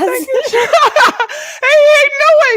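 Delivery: very high, loud, laughing squeal of thanks; excited squeal. Real, not acted. Streamer squealing thanks to a viewer for a gift. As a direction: squeal with delight over the gift.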